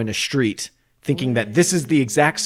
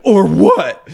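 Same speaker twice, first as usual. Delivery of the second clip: loud, high mock outrage; indignant challenge; 10 semitones higher, 11 dB louder than usual. Imagined complainer questioning a warning label, loud and indignant.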